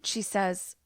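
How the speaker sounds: usual speaking voice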